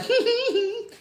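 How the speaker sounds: very high soft giggle; mock high tittering laugh; giggling lady. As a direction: giggle like a proud happy cook. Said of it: "She was like".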